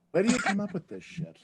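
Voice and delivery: dry, understated put-down; Bea Arthur. Acted. Impersonator as Bea Arthur reacting to a book reading, deadpan. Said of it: "legendary deadpan look and says".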